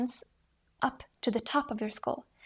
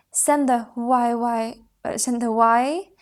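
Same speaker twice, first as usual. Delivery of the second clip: projected why; 7 dB louder, slower than usual. Shown as a demonstration. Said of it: "through the top of your head".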